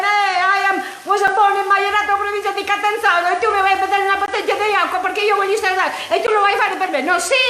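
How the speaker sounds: high, fast fiery Italian tirade; Italian scolding. Retold or imitated. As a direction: rattle it off proudly at the waiter. She scolds the waiter in Italian.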